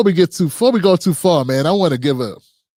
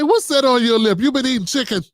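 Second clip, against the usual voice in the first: teasing grandpa; 6 semitones higher than usual. Retold or imitated. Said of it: "I say".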